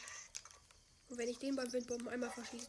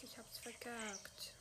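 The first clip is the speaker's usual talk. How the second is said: defeated whisper; 9 dB quieter, slower than usual. Real, not acted. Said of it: "repeats his failure".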